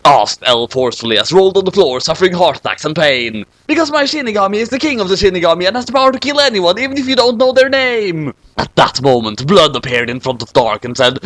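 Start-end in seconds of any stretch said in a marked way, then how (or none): 3.7-8.3 fast high gloat. Acted.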